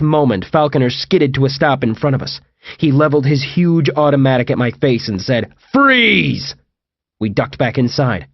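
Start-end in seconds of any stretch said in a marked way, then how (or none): 5.7-6.6 high, sustained yell; shouted command. A narrator's reading. Gunman levelling his automatic at a fugitive, shouted command. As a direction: order them to halt at gunpoint. Gunman orders them to halt.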